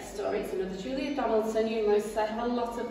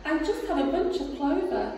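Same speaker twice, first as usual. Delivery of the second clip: much higher small rabbit voice; timid rabbit; 8 semitones higher, 5 dB louder than usual. Acted. Rabbit answering the rat, high and small. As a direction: squeak it out nervously. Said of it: "the rabbit replied".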